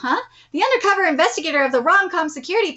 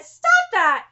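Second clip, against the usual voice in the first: very high-pitched cry of protest; alarmed scolding; 13 semitones higher than usual. Acted. Stopping a friend's kicking plan, high alarmed scolding. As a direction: cut in with a high scolding yelp. Character cuts off violent plan.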